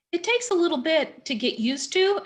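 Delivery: ordinary talk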